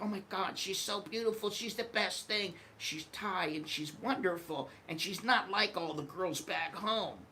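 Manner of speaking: soft, high, dreamy gushing; smitten gush. Retold or imitated. To himself about the Thai girl, softly smitten. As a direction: swoon over her quietly.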